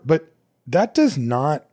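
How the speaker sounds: ordinary talk, nothing marked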